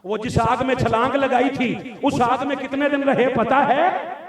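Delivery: loud, high preacher's challenge; challenging question. Real, not acted. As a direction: throw the question forcefully at the crowd. Challenging the congregation about the fire. (बुलंद आवाज़ में ललकारता सवाल)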